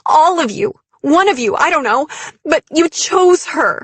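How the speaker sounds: loud, high, anguished outburst; shaken fury. A narrator's reading. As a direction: let the accusation burst out, overwrought. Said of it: "My voice shook".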